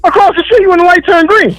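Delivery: exasperated rant. Real, not acted. Caller ranting at pedestrians.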